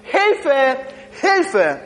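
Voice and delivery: shouting for help, dropping in pitch; cries for help. Retold or imitated. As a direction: call for help frantically. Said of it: "Er hat gesagt".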